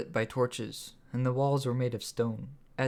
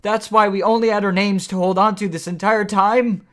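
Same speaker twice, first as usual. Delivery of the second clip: much higher and louder, outraged; shouted; 9 semitones higher, 14 dB louder than usual. A narrator's reading. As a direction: yell it at the villain in disbelief. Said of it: "Eric shouted".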